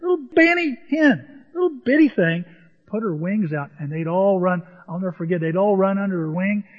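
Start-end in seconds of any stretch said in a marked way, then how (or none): 0.0-2.4 high-pitched cutesy little voice; tiny-thing voice. Retold or imitated. Preacher describes the little bitty hen, high and cutesy. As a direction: lift it high for something tiny.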